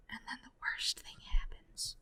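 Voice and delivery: whispered ominous aside; hushed suspense. Real, not acted. Friend builds dread in a spider story, whispered. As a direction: whisper it like a horror-story teaser.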